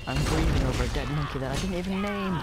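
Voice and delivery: loud, dramatic outburst; melodramatic shout. Real, not acted. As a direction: yell it in overblown grief.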